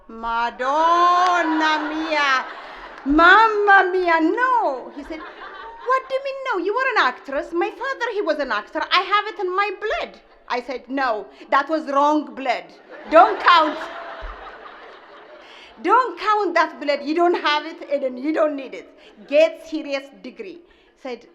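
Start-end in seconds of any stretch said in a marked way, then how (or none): 0.1-4.9 slow, loud Italian wail; mother hamming up her horror at acting; dramatic dismay. Mother horrified son wants acting. 5.9-10.2 higher, indignant retort; protesting son. Retold or imitated. Son protesting, voiced by mother. 10.9-20.6 louder, laughing rebuke; mother laughing down the acting dream; mock scolding.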